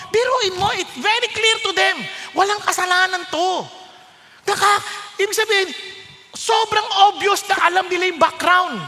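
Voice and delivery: high, loud preaching; fervent preaching. Acted. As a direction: drive the point home loudly. Preaching at full force.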